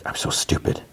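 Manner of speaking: hushed retold confession; soft voiceless admission; whispered self-reproach. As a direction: whisper it, imitating her embarrassment.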